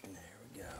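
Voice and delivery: soft unvoiced whisper; hushed whisper over the open hive. Real, not acted. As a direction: breathe it out so the bees stay calm. Working quietly near the bees.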